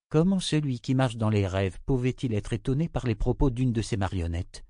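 ordinary delivery

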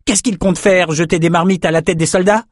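high-pitched exasperated question; very irritated. A narrator's reading. Young swordsman ranting about stubborn families, very irritated. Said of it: "très énervé".

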